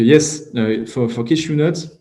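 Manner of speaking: English with the speaker's French accent, halting. Real, not acted. Trainer answering a cashew certification question in English, French accent. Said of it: "admirer mon superbe accent".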